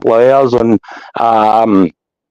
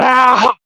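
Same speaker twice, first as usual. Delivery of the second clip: high mock scream of terror; imitated scream. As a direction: yelp like a spider is eating you. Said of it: "in their mouth going".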